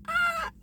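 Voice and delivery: short high mock shriek; a sudden squeal of shock, replayed; retold shriek. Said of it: "and I was like".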